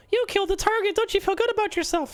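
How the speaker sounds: high, sing-song mission-update parody; mock the game's pat on the back, way up high. Streamer mocking the game's congratulations, high mock voice. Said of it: "They're just gonna be the usual".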